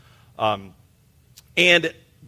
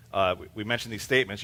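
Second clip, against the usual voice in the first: ordinary delivery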